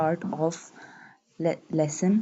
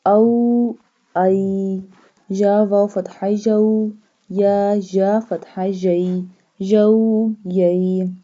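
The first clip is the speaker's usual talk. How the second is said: syllable drill; 6 dB louder, much slower than usual. Shown as a demonstration. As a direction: chant each letter, vowel and syllable for students to repeat.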